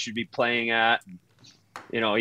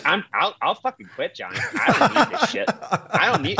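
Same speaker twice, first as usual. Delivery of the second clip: high mock voice, laughing by the end; Phil Kessel whining; 9 semitones higher than usual. Phil tells Johnny he'll quit, high mock whine. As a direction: pitch it up like a sulking star. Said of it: "he goes".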